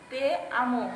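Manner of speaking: "তে আমো" said carefully as Latin te amo; careful Latin phrase; Latin pronunciation. Shown as a demonstration. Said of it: "Latin ভাষায় হচ্ছে".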